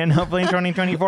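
hammed-up hopeful aside; mock hopeful. Repeated mock hopeful wish.

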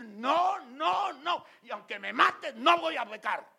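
high, loud defiant refusal; defiant shout. Acted. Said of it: "Usted decir". (grito desafiante, agudo y fuerte)

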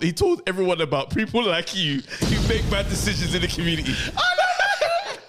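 cracking up mid-sentence; giggly high laughing words. Real, not acted. Laughing while telling the bit.

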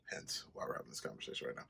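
quick whisper under the breath; fast whispered side remark; whispered aside. Real, not acted. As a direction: slip it in as a hushed aside. Muttered aside about his ex.

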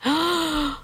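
surprised gasp. Real, not acted. May have found phone number.

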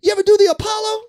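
high, loud challenge; excited demand. Retold or imitated. Emcee turning to young comic, excited demand. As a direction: fire the question out, voice way up.

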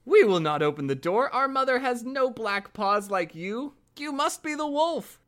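put-on high-pitched kids' voice; crying out. A narrator's reading. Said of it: "cried out".

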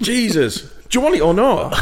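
high-pitched comic impatience; exasperated. Pretend. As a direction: lose patience mid-pitch, playfully. Pitcher loses patience with investor.

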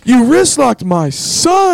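retold angry-parent shout; mock yell across mat. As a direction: call it out slowly across the mat.

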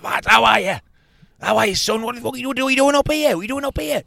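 high, loud excited impression; imitating Bentos. Bentos greeting him outside the pub, hammed up. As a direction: ham up a thrilled old teammate. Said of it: "Bentos, like".

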